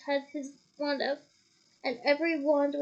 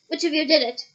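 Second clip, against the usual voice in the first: loud angry snap; 9 dB louder than usual. A narrator's reading. Mr Crouch snapping at the children in rage. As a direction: bite off the question. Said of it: "he snapped".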